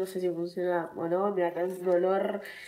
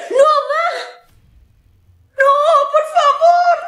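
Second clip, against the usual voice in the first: much higher, louder pleading no; mock panicked shrieks; 12 dB louder, slower than usual. Hearing she must get vaccinated, mock panicked shrieking. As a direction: shriek like a kid dreading a shot.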